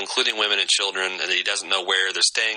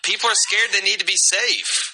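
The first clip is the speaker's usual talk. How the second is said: higher, mocking imitation of Rick's accentless line; 11 semitones higher than usual. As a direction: parrot the line a bit higher to mock the lost accent. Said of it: "See if you can detect any kind of accent".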